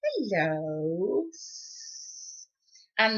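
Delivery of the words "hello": hissing snake. Acted. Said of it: "the snakes would hiss".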